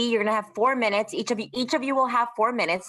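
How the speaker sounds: usual speaking voice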